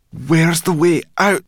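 urgent anxious question. Acted. Sorrel urgent.

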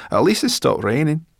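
high, loud creature voice; high little brownie, relieved; Sorrel, pleased. A narrator's reading.

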